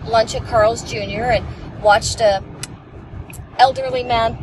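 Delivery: ordinary delivery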